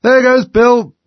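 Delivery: very high, loud cry; astonished, top-of-the-voice shout; chorus shout. A narrator's reading. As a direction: yell it like a whole crowd. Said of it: "a general chorus of".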